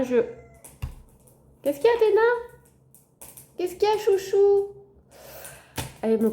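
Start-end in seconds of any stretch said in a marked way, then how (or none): 1.6-4.7 high, sing-song cooing to a pet; much higher, doting pet voice; pet talk. Real, not acted. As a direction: coo a question to your darling cat. Talking to her interrupting pet.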